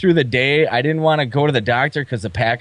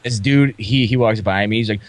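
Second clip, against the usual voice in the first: normal talking voice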